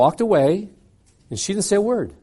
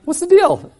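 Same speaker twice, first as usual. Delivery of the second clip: sharp high what's the deal; indignant question; 11 semitones higher, 5 dB louder than usual. Retold or imitated. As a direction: demand an answer, pitched up. Struggle over the test paper.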